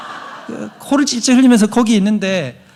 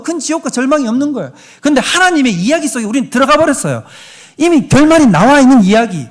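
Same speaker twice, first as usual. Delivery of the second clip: raised, driving preacher voice; fervent preaching; 6 semitones higher, 5 dB louder than usual. Acted. Preacher to the congregation, driving and raised. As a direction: preach it out with fire.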